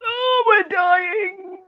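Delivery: high, very slow, hammed-up moan; mock dying wail. British people suffering in a heatwave, exaggerated high wail. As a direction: draw it out high as if wilting. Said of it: "and it's like".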